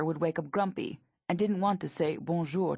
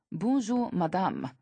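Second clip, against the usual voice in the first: ordinary delivery, nothing marked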